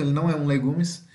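normal talking voice

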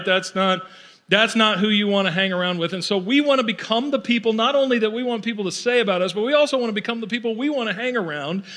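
ordinary delivery

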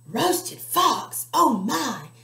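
high, loud gasp of alarm; alarmed fox. Acted. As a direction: panic at the thought.

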